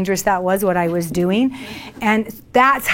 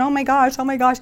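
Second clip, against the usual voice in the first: higher, rushed "oh my gosh" twice; mock panic; 4 semitones higher, faster than usual. Pulled over by a cop, flustered mock panic. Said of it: "I started going into panic".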